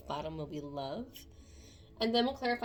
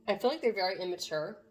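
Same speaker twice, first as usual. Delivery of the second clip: ordinary talk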